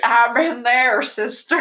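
lightly hammed Texas drawl; Texas accent. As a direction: imitate a TSA agent with a Texas accent. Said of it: "gave this Detroit T S A person a Texas accent".

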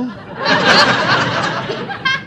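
loud studio audience laugh; audience laughter. Real, not acted. Studio audience laughing at the landlady. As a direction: crowd laughing hard.

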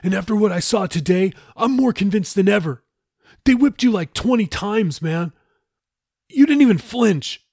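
high, heated insistence; building to a loud, amazed accusation; Jeffy, worked up. Acted. Jeffy insists Raoul can fight, worked up and high.

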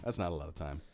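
deep, quiet repeated echo; low deadpan echo. Acted. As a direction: drop low and soft for the echo.

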